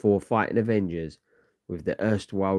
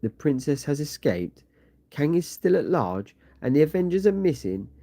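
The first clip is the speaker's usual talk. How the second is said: nervous usurper; 8 semitones higher than usual. Acted. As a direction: panic climbing with each piece of news. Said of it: "a new figure nervously sits upon the throne".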